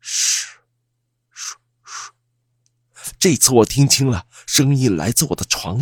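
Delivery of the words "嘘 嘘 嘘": soft, unvoiced "shhh" sounds; eerie whispered shush; faint ghostly shushing. A narrator's reading. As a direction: hush out faint, spaced shushes like an unseen ghost. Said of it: "那微弱的声音再次传进我的耳朵".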